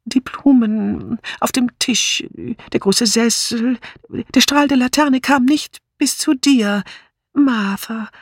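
slow, halting murmur, pitched high; verträumtes Murmeln. A narrator's reading. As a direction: murmur it slowly, as if seeing it with closed eyes. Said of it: "schloss die Augen und murmelte". (langsam und tastend gemurmelt)

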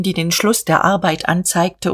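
usual speaking voice

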